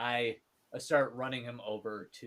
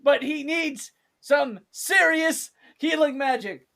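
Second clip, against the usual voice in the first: urgent plea; 14 semitones higher, 9 dB louder, slower than usual. Acted. Urging healer to help.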